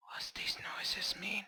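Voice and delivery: hushed whispered question; whispering. Pretend. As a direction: whisper it so nothing hears you. Same question repeated in whisper.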